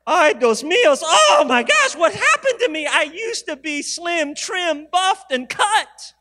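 high, loud comic wail over his weight gain; hammed-up dismay at the scale, laughing through it; mock panic. Pastor retelling to his church his horror at the scale, hammed-up high wail.

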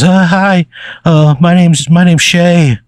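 meek, high-pitched little guy, hesitating; Shay. Acted. Pencil-shavings guy steps forward to introduce himself, meek and high. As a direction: stammer a timid introduction in a high voice. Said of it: "kinda meekly steps forward".

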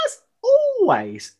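"always" stretched slow and very high; drawn-out high repeat. Acted. Echoing the intro in a slow, high sing-song.

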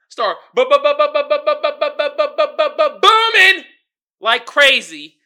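high, loud stuttered bu-bu-bu; stuttering boom. Pretend. Imitating his news booming with a high stuttered bu-bu-bu. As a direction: hammer out the boom like a beat drop.

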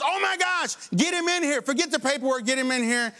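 high, alarmed orders; shocked supervisor. Retold or imitated. Supervisor seeing the broken arm, high alarmed orders. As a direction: react in shock and order them in.

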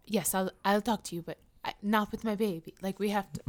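a light mock Spanish accent, softly; fake Spanish accent. Celebrity wife to paparazzi, lightly mocked Spanish accent. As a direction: imitate a put-on Spanish accent, subdued. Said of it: "got that fake Spanish accent".

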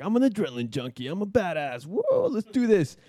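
cocky hype rising to a high whoop; pumped-up bravado. Retold or imitated. Teenage first-timer before jumping, pumped-up boasting. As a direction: build from quick boast to whooping. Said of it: "I'm all pumped".

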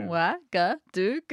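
Ouagadougou slow, each syllable separate; sounding it out. Shown as a demonstration. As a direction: spell Ouagadougou out by ear, syllable by syllable. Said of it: "exactly the way it sounds".

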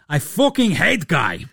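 slow, put-on Bosnian accent; wobbly Bosnian accent. Nurkic going at his rival, mock Bosnian accent. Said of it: "that accent went a couple of different ways".

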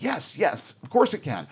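eager, high-pitched retold yes; exuberant. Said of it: "is very exuberant here".

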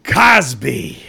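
slow, drawn-out mock anger; spitting it out. A narrator's reading. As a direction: spit the name out bitterly. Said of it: "as he spit out the word".

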